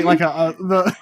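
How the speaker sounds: ordinary delivery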